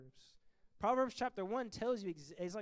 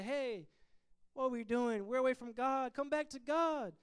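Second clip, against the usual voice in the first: calling out; 5 semitones higher, 6 dB louder than usual. Retold or imitated. People calling Israel back.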